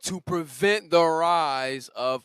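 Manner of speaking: slow, weighty repetition; slow emphasis. Real, not acted. Preacher repeats the goal for the congregation, slow and weighty. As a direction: let each word land, slowly.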